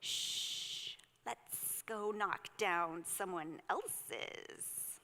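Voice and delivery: hushed, slow whisper of a snake; sneaky whispering snake. Acted. Snake in the story plotting mischief, slow sneaky whisper.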